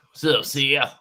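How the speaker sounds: badly faked Louisiana accent; hammy attempt at a Louisiana accent; horrible Louisiana accent. Shown as a demonstration. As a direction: greet with a clumsy Louisiana twang. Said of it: "That's a horrible Louisiana accent".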